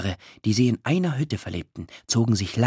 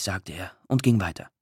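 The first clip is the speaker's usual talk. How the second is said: plain reading voice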